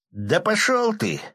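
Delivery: high, slow defiant yell; defiant shout. Acted. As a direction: throw the insult back. Bandit's defiant retort.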